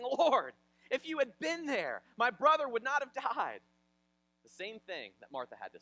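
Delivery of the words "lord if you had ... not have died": loud, high, anguished cry of reproach; impassioned plea. Retold or imitated. Mary falls at Jesus' feet, loud impassioned plea. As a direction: cry it out to the Lord.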